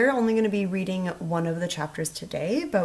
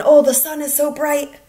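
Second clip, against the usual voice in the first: 1 pretend dazzled; 8 semitones higher, 10 dB louder than usual.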